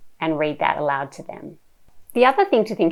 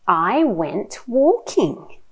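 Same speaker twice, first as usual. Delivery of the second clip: animated, slow picture-book reading with big swoops; reading to a toddler; 4 semitones higher, 6 dB louder, much slower than usual. Shown as a demonstration. Mum sharing a picture book with her little one, animated child-directed reading. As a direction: draw out the words, playful and full of wonder.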